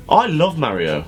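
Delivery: ordinary talk, nothing marked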